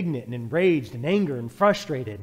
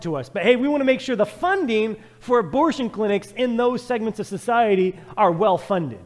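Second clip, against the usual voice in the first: fiery preaching; 7 semitones higher, 8 dB louder than usual. Acted. Preacher condemning society's hypocrisy, loud and driving. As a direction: hammer each phrase home.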